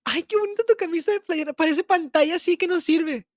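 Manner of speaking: high, sing-song valley-girl put-on; mock airhead girl. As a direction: mimic a ditzy girl gushing over a shirt. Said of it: "De que así era, de que te miren".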